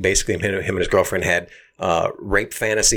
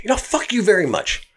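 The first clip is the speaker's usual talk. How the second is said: mock insult; 12 semitones higher than usual. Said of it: "he's like".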